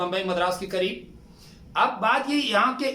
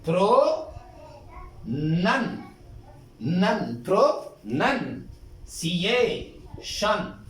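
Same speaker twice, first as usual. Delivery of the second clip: slow teaching breakdown, pro, nan, si-ye, shan; long pauses between پرو, نن and سی اے شن; syllable by syllable; much slower than usual. Shown as a demonstration. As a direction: model each syllable separately and slowly. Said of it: "دیکھیں".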